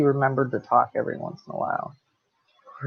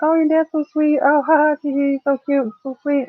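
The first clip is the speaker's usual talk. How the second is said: high, cooing mock admiration with fake giggles; mock gushing admirers; 9 semitones higher than usual. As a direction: coo it like a smitten stranger praising him. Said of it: "They're like".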